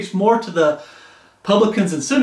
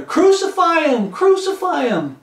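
mock crowd shout; 9 semitones higher than usual. Preacher voices the fickle crowd turning on Jesus, mock shout. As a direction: cry it out like a mob.

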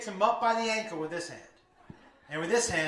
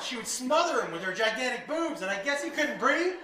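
ordinary talk

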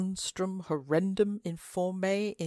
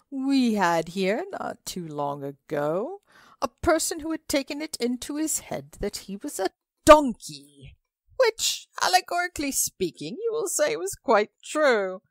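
a tall guest spinning a yarn, pitched up and louder; tall man's voice; 9 semitones higher, 6 dB louder than usual. Acted. Tall man tells the table about a patient, raised voice.

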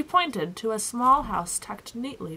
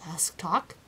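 ordinary delivery

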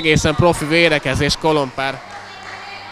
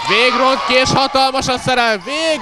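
excited sports commentary; 9 semitones higher than usual. Acted. Live play-by-play rising.

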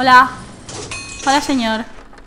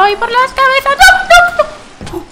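high, loud panicky cry; mock panic; 11 semitones higher, 4 dB louder than usual. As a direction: squeal in fake panic. (pánico fingido y agudo)

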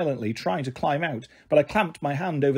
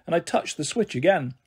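usual speaking voice

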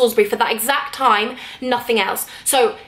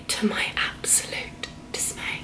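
slow, breathy stage whisper, much softer; dramatic whisper; 3 semitones lower, 13 dB quieter, slower than usual. Retold or imitated. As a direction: whisper it like a tragic secret. Dramatic reveal of lost tickets.

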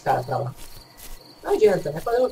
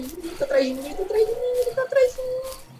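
panicking more with each repeat; rising panic. Real, not acted. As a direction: voice climbing higher in fear. Monster chasing him.

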